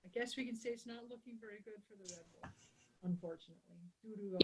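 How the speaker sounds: volume drops to almost nothing, dropping out; audio cutting out. Real, not acted. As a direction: voice fading out like a bad connection. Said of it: "Your audio is cutting out".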